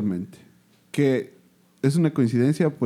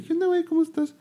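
high friendly greeting; faster than usual. Retold or imitated. Mimicking a gatecrasher's greeting.

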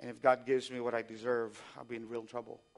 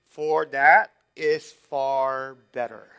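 For that is far better, slow and measured; congregation recital; 11 dB louder, much slower than usual. Shown as a demonstration. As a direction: lead the room in saying it. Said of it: "would you say this with me".

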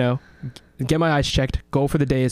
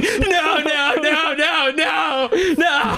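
high frantic pleading, half laughing; panicked no; much slower than usual. Retold or imitated. Scalded cook crawling away, panicked pleading. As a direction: beg it away while crawling.

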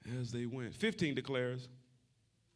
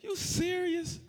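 high, laughing mock disbelief; a hint of a fed-up Jesus; mock exasperation; much slower than usual. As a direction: sneer at him playfully, laughing. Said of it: "I'd be like".

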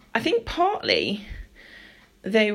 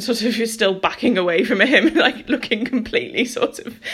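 laughing while telling; 2 semitones higher, 5 dB louder than usual. Real, not acted. Speaker recalling the frightened women, laughing through the words. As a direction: talk through a louder laugh.

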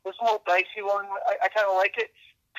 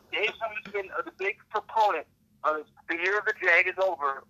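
normal talking voice

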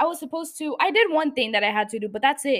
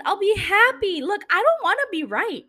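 raised, emphatic rant; loud frustrated rant; exasperated outburst; 5 semitones higher, 4 dB louder than usual. Real, not acted. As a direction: throw up your hands and let it out.

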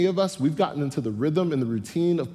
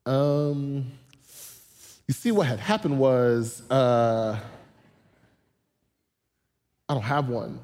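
evasive stalling; slower than usual. Retold or imitated. Woman evading about husband.

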